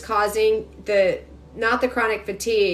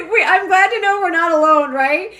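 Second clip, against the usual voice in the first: higher and louder through laughter; laughing; 8 semitones higher, 5 dB louder than usual. Real, not acted. Reacting to funny viewer comments, laughing. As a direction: let the laugh carry the words.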